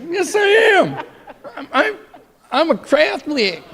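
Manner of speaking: very high squeaky insistence through laughter; hammed-up high-pitched pleading; mock protest. Acted frantic answer to accusers.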